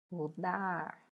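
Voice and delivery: DAR of Mudar drawn out; calling the word. Shown as a demonstration. Teacher tests the stressed syllable, Mudar called with a long DAR. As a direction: call Mudar with a lengthened final DAR.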